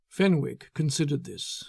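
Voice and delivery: plain reading voice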